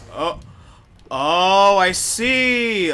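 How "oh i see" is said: excited realization. Real, not acted. Realizing mechanic.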